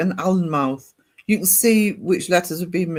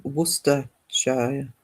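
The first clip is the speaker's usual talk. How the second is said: slow careful pronunciation; 5 semitones lower, 6 dB quieter, much slower than usual. Shown as a demonstration. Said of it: "Well, I say Worcestershire".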